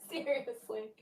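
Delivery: usual speaking voice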